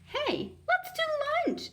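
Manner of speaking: very high, eager exclamation; excited exclaim. Acted. New girl excitedly inviting the bully to lunch. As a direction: squeal the invitation. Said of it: "exclaimed Carla".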